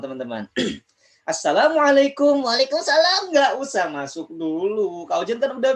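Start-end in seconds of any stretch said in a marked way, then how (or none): 2.4-3.6 much higher, eager puppet voice; puppet friend voice. Acted. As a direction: pitch it way up like a hand puppet. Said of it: "Temen Kak Ojan udah nggak sabar".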